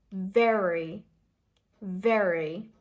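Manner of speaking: "Very" with a strong curled R; "very" drawn out, R clearly sounded; strong American R. Shown as a demonstration. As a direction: lean into the R of "very". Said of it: "it's a strong ra sound".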